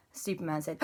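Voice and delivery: ordinary delivery, nothing marked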